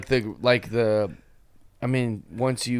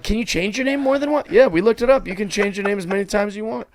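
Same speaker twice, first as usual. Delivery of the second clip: excited question; 7 semitones higher, faster than usual. Retold or imitated. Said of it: "they're like".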